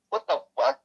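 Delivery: high, squeaky disbelief; shocked. Retold or imitated. Uncle reacting to the girl's robotic answer, high and shocked. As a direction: yelp it in stunned disbelief.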